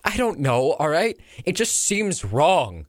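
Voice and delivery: high, pained objection; anguished reluctance, pitch climbing; distressed protest. Acted. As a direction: plead against killing a comrade. Soldier objects to mercy killing.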